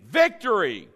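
loud, punchy VICTORY; said like you mean it. Shown as a demonstration. Preacher urging congregation to mean it, loud and emphatic. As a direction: belt out the word with conviction. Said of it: "Now say it like you mean it".

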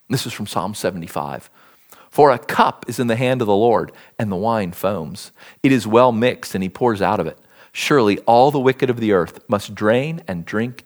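scripture reading. Acted. Preacher reading wrath passages to his church, grave and low. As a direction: let the words of judgment weigh on the room. Reads prophets on God's wrath.

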